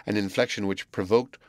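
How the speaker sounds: ordinary delivery, nothing marked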